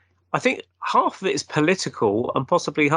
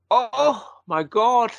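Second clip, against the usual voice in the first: high, shocked Scottish impression; mock-shocked exclamation in a Scottish accent; 7 semitones higher, much slower than usual. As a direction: imitate a startled Scot. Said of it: "in his Scottish accent".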